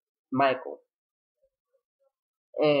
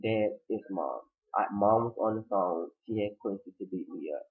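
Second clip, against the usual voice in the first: low, soft hurt confession; 6 semitones lower, 5 dB quieter than usual. Retold or imitated. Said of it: "She's like".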